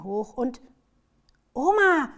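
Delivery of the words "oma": high, loud excited call. A narrator's reading. Child alerts grandma to the dog, excited call. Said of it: "sagt Lotte aufgeregt".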